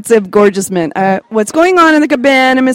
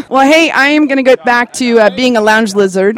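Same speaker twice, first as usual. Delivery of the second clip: ordinary talk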